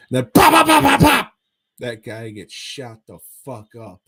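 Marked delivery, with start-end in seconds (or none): 0.1-1.3 loud high gunshot pops. Retold or imitated. Imitating gunshots in movie retelling.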